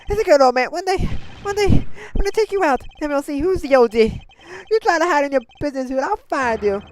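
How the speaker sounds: high, loud teasing threats; defiant shout. Acted. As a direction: yell it at the old man.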